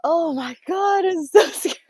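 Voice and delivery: loud, high, half-laughing fright; laughing scared shout. Real, not acted.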